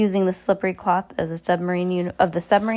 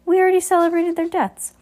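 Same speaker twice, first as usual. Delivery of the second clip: much higher, louder astonished voice; 12 semitones higher, 5 dB louder than usual. A narrator's reading. Esme stunned the orphans are alive, high astonished cry. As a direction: shriek it in disbelief. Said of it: "she asked, astonishment".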